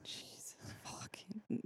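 breathy slow whispered curse; exasperated whisper. Real, not acted. Recalling dial-up agony, a slow whispered curse. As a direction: hiss it out in disbelief.

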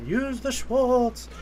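high, very slow, drawn-out mentor voice; Yogurt. Retold or imitated. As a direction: stretch it out like a mystic voice from afar. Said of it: "you hear Yogurt's voice".

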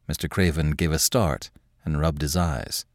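ordinary delivery, nothing marked

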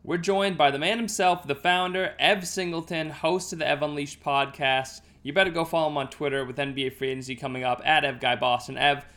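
loud, upbeat host intro; podcast intro. Acted. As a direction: welcome listeners with energy.

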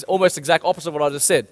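usual speaking voice

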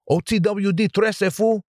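Chicano gang accent. Shown as a demonstration. Podcast host redoing the show name in a Chicano gang accent. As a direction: roll it out like an East LA homeboy. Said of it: "I love doing that voice".

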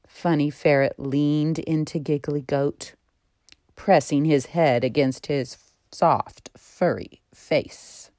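usual speaking voice

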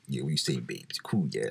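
low, soft chat-up in slang; slang-talking guy. Acted. A guy approaching a woman at uni, low slangy chat-up voice. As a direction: drop it low and quiet like a slangy lad. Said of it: "started talking like".

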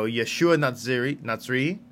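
ordinary talk, nothing marked

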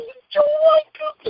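put-on a starstruck little girl. Little girl voiced excited.

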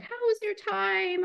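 high, breezy boss asking about a trip; cheery manager small talk; manager's voice. Retold or imitated. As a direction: lightly mimic a chirpy manager. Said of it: "my manager was like".

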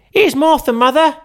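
put-on very high, loud young girl calling out; a cheeky girl popping in to surprise her mother; girl's voice. Martha announces herself.

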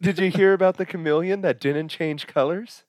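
high silly joke voice. Acted. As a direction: set up the joke in a high goofy voice. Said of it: "Shall I keep this voice for the next joke".

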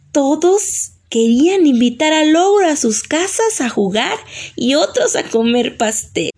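usual speaking voice

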